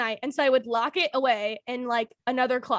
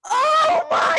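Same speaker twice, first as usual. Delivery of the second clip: alarmed mom; 5 dB louder than usual. Retold or imitated. Mom running up stairs, alarmed.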